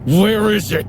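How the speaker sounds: high, tense hiss through teeth. A narrator's reading. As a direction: spit it through clenched teeth. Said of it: "he hissed through clenched teeth".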